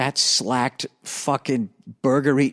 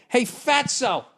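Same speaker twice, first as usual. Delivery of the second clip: imagined telling-off; 8 semitones higher than usual. Pretend. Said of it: "I so wanted to be like".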